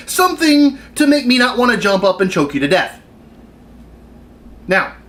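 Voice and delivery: ordinary talk, nothing marked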